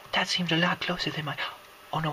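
ordinary delivery

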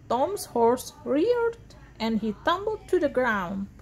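usual speaking voice

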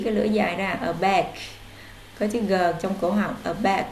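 ordinary delivery

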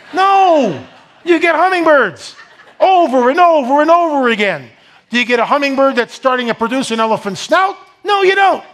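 high, loud, laughing rebuttal; fervent shouting. Real, not acted. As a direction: hammer the point home to the crowd. Preaching climax, shouting rebuttal.

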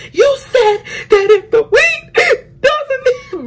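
very high, drawn-out tearful wail; hammed-up squealing cry; retold crying scream. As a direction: act out sobbing while screaming, slowly stretched. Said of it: "I'm fucking crying. I'm getting very tense".

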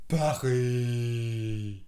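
long, low, stretched word; low drawn-out repetition. Shown as a demonstration. Teacher leading repeat drill on French city, low and drawn out. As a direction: drag it out low.

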